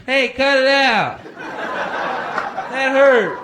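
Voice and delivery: high, loud protesting whine, slowed; whiny surveyor. Retold or imitated. Surveyor yelling at the car that hit him, high and whiny. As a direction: complain to the driver who hit you. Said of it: "make that guy go in front of traffic".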